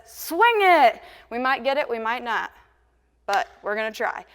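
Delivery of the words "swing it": higher, louder call of the lyric. Retold or imitated. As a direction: sing out the cue like the singer. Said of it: "when he says".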